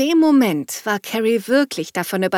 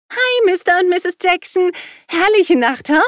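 put-on high, louder, cheeky girl's greeting; grinning girl; 9 semitones higher, 6 dB louder than usual. A narrator's reading. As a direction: wave and grin at the neighbours. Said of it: "winkte und ihnen beiden zugrinste". (frech grinsendes Mädchen, hoch und laut)

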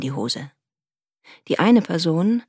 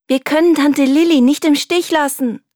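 put-on high, loud girl's plea; girl begging grandfather not to leave aunt, high and loud; calling out; 9 semitones higher, 8 dB louder than usual. A narrator's reading. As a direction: call out to grandfather, insisting. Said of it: "rief sie ihrem Großvater zu".

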